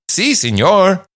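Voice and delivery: put-on "Si, senor" pitched high in a caricature Mexican accent; hammed-up, sing-song Mexican accent; mock Mexican accent. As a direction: imitate an exaggerated Mexican "Si, senor". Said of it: "you went".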